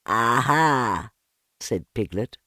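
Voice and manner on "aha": shaken, feeble aha; weak aha. A narrator's reading. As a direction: try for brave after a fright. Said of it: "as well as he could after his terrifying journey".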